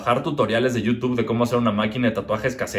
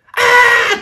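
high, loud mock scream of excitement; imitated excited scream; 8 dB louder than usual. Imitating wife bursting in with a shriek. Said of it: "ella entró gritando".

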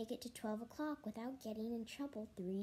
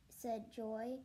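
ordinary delivery, nothing marked

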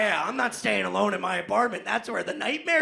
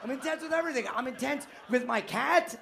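normal talking voice

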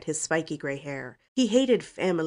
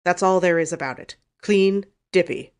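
angry snort; 2 semitones higher, 8 dB louder than usual. A narrator's reading. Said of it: "he snorted angrily".

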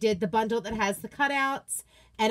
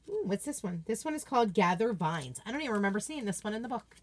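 ordinary talk

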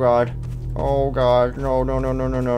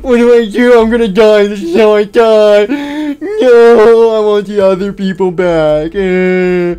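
very high, loud terrified yelling; panicked scream; 6 semitones higher, 10 dB louder, slower than usual. Real, not acted. Monster appears, panics.

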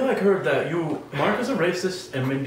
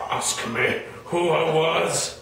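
slow Scottish impression of Mark; drawn-out Scots brogue; Scottish accent; much slower than usual. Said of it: "Why are you sounding like you're doing a Scots dialect".

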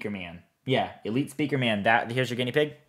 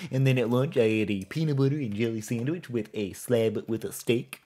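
slightly raised, mannered character voice; put-on character voice; 4 semitones higher, 3 dB louder than usual. Streamer riffing on the day's meals, in a put-on character voice. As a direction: play a character chatting about meals.

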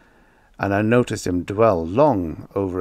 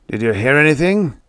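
loud, raised, accusing question; harsh; 5 semitones higher, 6 dB louder than usual. A narrator's reading. Tusman interrogates the servant, harsh and accusing. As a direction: ask it harshly, almost threatening. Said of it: "asked Tusman harshly".

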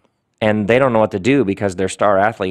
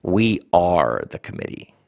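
lower, weighty, certain delivery; gravitas; 4 semitones lower than usual. Shown as a demonstration. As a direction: say it with weight and timbre. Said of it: "You say it with some gravitas".

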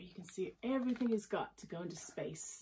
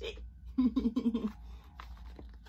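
hiccupy little giggle; 3 semitones higher than usual. Acted. Jellyfish laughs at Unicorn's nickname, giggling. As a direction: giggle in a quick run. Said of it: "giggled Jellyfish".

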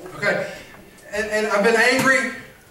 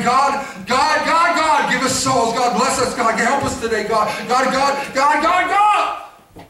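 fervent prayer; 3 semitones higher, slower than usual. Acted. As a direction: build an urgent, pleading intercession. Intercessors praying fervently.

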